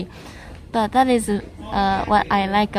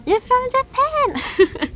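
high, drawn-out delighted squeal; excited surprise; 12 semitones higher, much slower than usual. Real, not acted. Streamer finding out a fellow streamer's origin, excited surprise. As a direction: burst out high, stretching the last word. Surprised learning where viewer is from.

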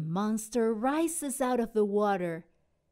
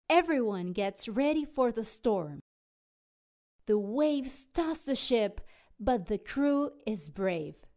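ordinary delivery, nothing marked

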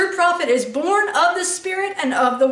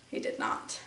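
whispered answer; hushed whispered denial; 14 dB quieter than usual. Real, not acted. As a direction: breathe it, unvoiced. Rhetorical answer to own question.

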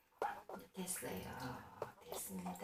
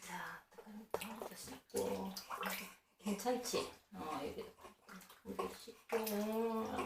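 whispered, hushed; whispering; 2 semitones lower, 13 dB quieter than usual. Real, not acted. 조용히 아기를 씻김. (속삭이듯 조용히)

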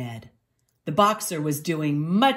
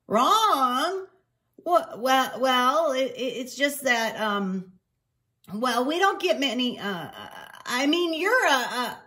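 high, halting, embarrassed clerk; flustered stammering; 7 semitones higher than usual. Acted. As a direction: stumble over your words awkwardly.

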